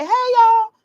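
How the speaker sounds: high, loud acted hello; greeting Southern colleagues. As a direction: call out a big friendly y'all greeting. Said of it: "talking to my Southern colleagues and I'm like".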